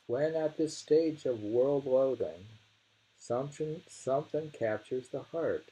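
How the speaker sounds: poem reading. Acted. Reading the verse again to a friend, declaiming.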